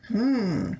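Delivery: ordinary delivery, nothing marked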